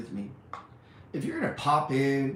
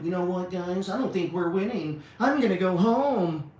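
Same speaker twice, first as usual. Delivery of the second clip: a higher, whiny imitation of a soldier giving up; mock quitter; 6 semitones higher, 5 dB louder than usual. Mocking a soldier quitting a losing war, higher whiny imitation. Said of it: "You're like".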